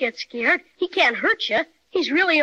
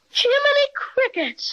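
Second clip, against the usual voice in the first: high, slow exclamation of wonder; a boy's wide-eyed astonishment; amazed gasp; 9 semitones higher than usual. Acted. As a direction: gasp it out in awe. Boy peers at the bear.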